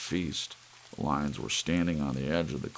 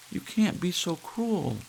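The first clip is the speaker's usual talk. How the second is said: pleading mother; 13 semitones higher than usual. Acted. Lydia pleads with George.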